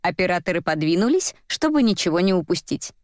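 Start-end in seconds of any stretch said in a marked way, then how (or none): none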